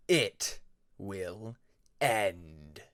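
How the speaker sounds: very slow, low dark threat; menacing dragon. Acted. Shadow dragon circling Peacemaker, very slow and low. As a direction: drag each word out like doom.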